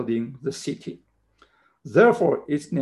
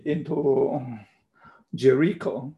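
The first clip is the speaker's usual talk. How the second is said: normal talking voice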